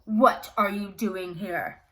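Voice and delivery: a hint of a menacing beast; lightly voiced beast confronting intruder; beast's demand. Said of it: "heard a loud voice call out".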